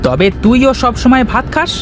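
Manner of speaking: put-on high child voice, hitting back; a boy turning the tease around; retorting little boy. Joy hits back at Rana, high and cheeky.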